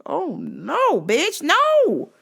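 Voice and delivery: high, loud sassy scolding; high mock-outraged sass; sassy disbelief. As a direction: shut her down with attitude. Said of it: "I'm like".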